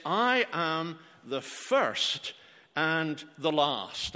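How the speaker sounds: very slow, every word weighed; slow solemn emphasis. Acted. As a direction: lay each word down slowly and solemnly. Quotes Jesus' words slowly.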